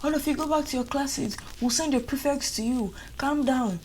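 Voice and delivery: high, mildly voiced official yelling; high-pitched mock command; mock shouting staff. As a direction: imitate the staff calling for calm. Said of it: "management are just shouting".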